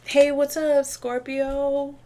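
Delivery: high, bright friendly hello; playful jovial hey; jovial greeting. Retold or imitated. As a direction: greet them like an old pal. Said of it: "You're, like, jovial".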